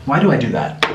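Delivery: usual speaking voice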